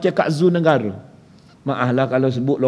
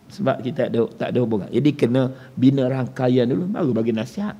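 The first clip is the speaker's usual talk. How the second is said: ordinary talk, nothing marked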